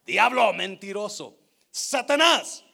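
loud rebuke, punched on Satanás; preacher's thundering quote of a rebuke; acted rebuke. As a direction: cast him out with a forceful accusation. Acts out Jesus's rebuke to Peter.

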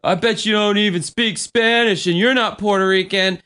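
high, mock drunk taunt; Kimmy. Drunk woman challenging bandmate's heritage, high mocking imitation. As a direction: imitate a belligerent drunk picking at someone. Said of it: "Kimmy's like".

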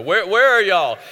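a hammed-up Southern call; Southern drawl. Preacher voicing a Southern God calling out, mock Southern drawl. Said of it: "'Cause God's Southern".

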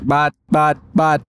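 robot voice stuck repeating; glitching robot stutter. Acted. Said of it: "you're kinda freezing up there".

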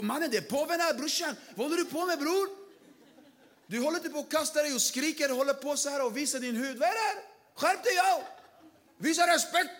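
shocked local shouting skärp dig; scandalized onlooker. Retold or imitated. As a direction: tell off a brother for making a scene.

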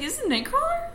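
high-pitched wondering; high question. Retold or imitated. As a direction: ask it high in surprise. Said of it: "I was like".

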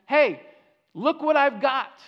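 loud, high call across a distance; hey, make them hear you across the hill; yelling across the gap. Retold or imitated. David yelling to Saul across the gap, loud and high. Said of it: "he yells across the gap".

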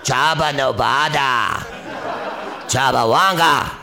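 alien babble with laughter; alien gibberish. Pretend. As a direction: burble it like a Star Wars alien. Star Wars alien gibberish.

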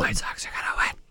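mocking hushed whisper. Real, not acted. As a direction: whisper it back teasingly. Repeating the prediction in whisper.

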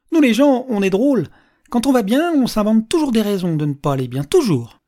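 high, loud public pronouncement; theatrical old man sharing his theory; holding forth. Acted. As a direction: lecture the carriage with gusto. Old man holds forth to carriage.

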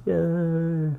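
high, bright little cheer; cheery yay. Real, not acted. Host cheering over a Christmassy cup, high.

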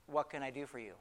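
soft, low, tender question; hushed, kindly; gentle Jesus. Acted. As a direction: offer help softly and low. Jesus speaks tenderly to blind man.

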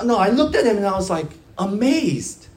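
ordinary talk, nothing marked

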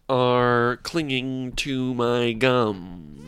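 very slow, drawn-out impression; John Malkovich. Host continuing his impression for co-host, slow and deliberate. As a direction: stretch every word with deliberate precision. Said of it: "my John Malkovich impression".

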